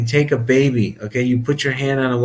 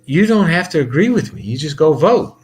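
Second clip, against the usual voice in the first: raised retort; 5 semitones higher, 3 dB louder than usual. Retold or imitated. Retold argument with angry caller.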